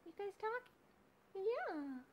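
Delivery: high chirpy pet voice; high sing-song hello to the pets. Real, not acted. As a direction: pitch way up to greet the birds. Baby talk to birds.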